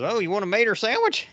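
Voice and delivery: a country uncle offering his favorite snack; folksy uncle. Retold or imitated. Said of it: "And he goes".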